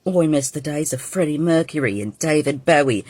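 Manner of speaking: normal talking voice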